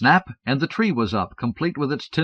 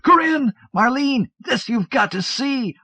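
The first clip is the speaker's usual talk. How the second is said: much higher, loud calls, slowing at the end; the twelve-year-old calling her sisters to come see; excited calling; 4 dB louder, slower than usual. Acted. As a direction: call the sisters with an odd edge. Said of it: "The twelve-year-old cried".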